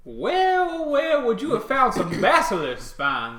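high, loud astonished question; amazed. Acted. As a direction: act stunned by the news. Shopkeeper NPC amazed.

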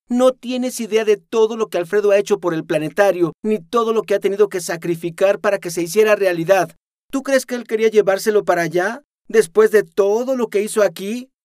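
high, loud indignant reproach; heated defense. Acted. Noemí scolding Aris to defend Alfredo, indignant and loud. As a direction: throw it back at her, offended.

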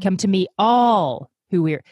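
"all" loud and falling in "Come to me, all who weary"; "all" lifted and loudest in the phrase; stress on all. Shown as a demonstration. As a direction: hit "all" hard. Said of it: "put the emphasis on a different word each".

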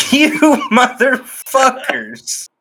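mock fury through laughter; half-laughing indignant curse; laughing outrage. Counted wrong for capitalization.

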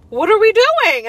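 high, loud playful surprise; doctor surprised to see her again. Retold or imitated. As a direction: greet her with mock astonishment.

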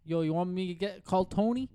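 high, loud mock New York accent; a hammed-up New Yorker offering to call Tony; teasing in an exaggerated New York accent. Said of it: "in a New York accent".

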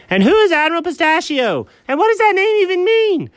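very high, incredulous squeal; exasperated demand. Acted. Baffled friend questioning Admiral Pistachio's name, exasperated. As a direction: demand answers, voice shooting up high.

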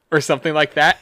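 normal talking voice

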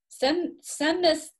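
high, slow voice for his dad; imitating dad. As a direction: raise the pitch and slow down for father. Father's request voiced in story.

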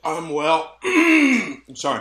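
coughing and choking mid-sentence; clearing throat, half choked; choking on a protein bar. Real, not acted. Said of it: "I'm choking on a protein bar".

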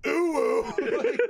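high excited whoop. Retold or imitated. Retold next-morning excitement.

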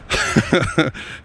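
airy, high chuckle; rueful laugh. Real, not acted. Comic imagines friends trashing him, airy self-mocking laugh. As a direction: laugh at your own expense.

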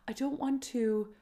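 ordinary delivery

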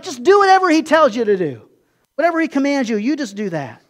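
loud, high, insistent mother telling them; no arguing, just do it; insistent Mary. Retold or imitated. Mary insists the servants obey Jesus, loud and high.

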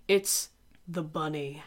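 slow, low ominous reveal; spooky reveal. Acted. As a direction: drop low and slow, make it creepy.

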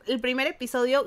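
usual speaking voice

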